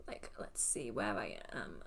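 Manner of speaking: low, breathy whisper to herself; hushed muttering while searching; whispered aside. Real, not acted. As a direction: whisper it while scanning the list. Searching quietly for her place.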